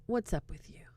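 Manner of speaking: unvoiced whisper up close; whispered come-on. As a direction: whisper it in his face. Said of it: "gets right in his face".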